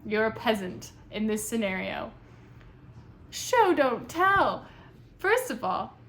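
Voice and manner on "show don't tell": slow, loud, high, every word hit; slow emphatic rule. Real, not acted. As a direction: spell out the writing rule slowly. Critique of the writing.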